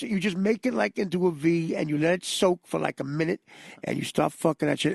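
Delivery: ordinary talk, nothing marked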